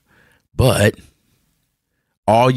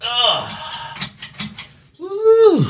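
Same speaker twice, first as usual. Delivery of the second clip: hot groan and whoop. Real, not acted. Overheating in hot room.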